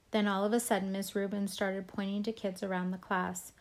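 ordinary delivery

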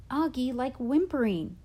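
a sister losing patience with her brother; impatient. A narrator's reading. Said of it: "she answered impatiently".

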